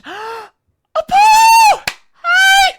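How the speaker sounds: gasping, very high and loud squeal of joy; excited shriek. Real, not acted. Streamer spots a friend in chat, delighted squeal.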